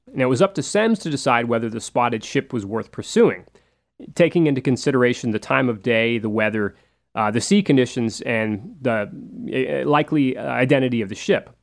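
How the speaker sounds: normal talking voice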